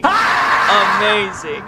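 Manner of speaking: very slow, loud laughing yell; laughing shout. Real, not acted. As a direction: burst out laughing on it.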